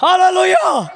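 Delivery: high ringing shout of "hallelujah"; loud hallelujah. Real, not acted. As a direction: call it out to the congregation. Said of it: "గట్టిగా హల్లెలూయా చెప్పండి". (గట్టిగా హల్లెలూయా)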